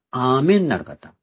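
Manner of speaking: ordinary talk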